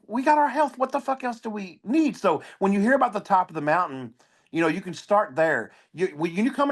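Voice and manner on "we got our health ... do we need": upbeat realization. Retold or imitated. His upbeat reaction quoted.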